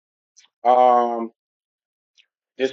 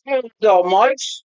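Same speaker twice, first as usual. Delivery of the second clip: bad English accent; 8 semitones higher, much slower than usual. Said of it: "My English accent sucks".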